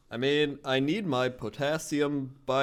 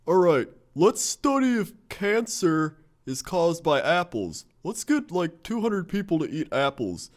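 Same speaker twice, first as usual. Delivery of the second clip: mock researcher; 7 semitones higher, 6 dB louder than usual. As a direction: play a clueless scientist proudly. Voicing naive researchers.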